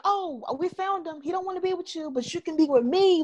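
sweetly needy parody of her; imitating Josie cooing at him; mocking Josie. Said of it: "And she just like".

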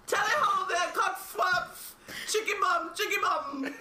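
high, hammed-up British gibberish accent; wildly off-the-rails London accent; garbled mock Cockney. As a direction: call it out in a silly fake Cockney. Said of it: "What accent is that?".

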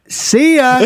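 high, loud "see ya" send-off; mock parting shout. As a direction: call it out high and loud, teasing. Mocking retelling of the drink spill.

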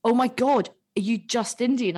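a hint of a student, let down; mock disappointment. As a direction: let the surprise deflate. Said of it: "And she was like".

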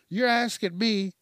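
ordinary talk, nothing marked

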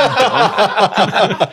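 half-laughing yelled word; laughing mock yell; mock scream. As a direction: shout it mid-laugh. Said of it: "Just, like, screamed".

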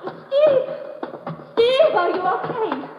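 a touch of alarm after gunfire; worried call. Acted. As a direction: call after him, afraid he's hurt. Anna calls out after shots.

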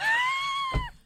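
squeaky laugh; real laugh; high squeal of laughter. Real, not acted. Laugh during retelling.

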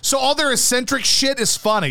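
ordinary talk, nothing marked

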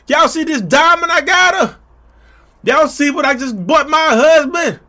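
high, loud showing-off call; hammed-up high proud announcement; mock bragging shout. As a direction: brag at the top of your voice.

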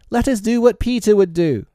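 put-on high, loud childlike cry; rally the gang in a high boy's voice; loud, high-pitched resolve; boys rallying. A narrator's reading. Boys rallying against the wolves.

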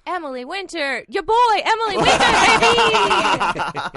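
high, loud hype shout; hype intro. Retold or imitated. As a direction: hype herself up like a host. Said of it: "Your boy, Rohan Padye, baby".

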